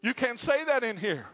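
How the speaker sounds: high, loud shocked exclamation; shocked outburst. Retold or imitated. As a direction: gasp it out, scandalized. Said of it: "The lady leading the program gasped".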